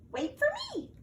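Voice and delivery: very high little mouse cry; mouse calling. Acted. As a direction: call out like a tiny mouse left behind. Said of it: "cried Mouse".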